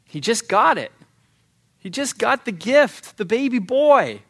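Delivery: high-pitched, pleading disbelief; incredulous protest. Acted. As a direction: protest it as if it's unfair, pitch climbing. Protests God's demand for the son.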